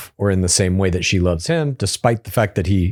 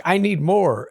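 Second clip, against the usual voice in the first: higher, lightly voiced needy girlfriend; a hint of a demanding partner; mock needy fiancée; 9 semitones higher than usual. Voicing Annie in recap.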